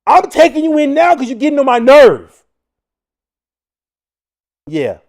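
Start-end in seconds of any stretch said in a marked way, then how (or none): 0.1-2.4 fed-up cop. Retold or imitated. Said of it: "I'd be like".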